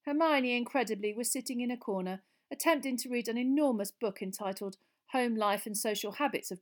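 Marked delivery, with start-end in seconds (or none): none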